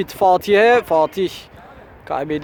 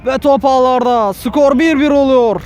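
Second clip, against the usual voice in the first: screaming football commentator; 8 semitones higher, 6 dB louder than usual. Real, not acted. Equaliser goal, commentator erupts.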